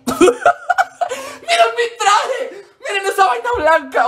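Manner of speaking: high, slow, laughing mock call; laughing mock shout. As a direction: laugh while shouting the taunt. Kids on buses mocking her.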